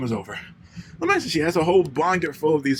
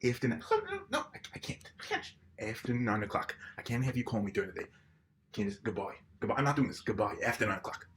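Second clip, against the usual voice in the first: hushed phone aside; 4 semitones lower, 9 dB quieter than usual. Acted.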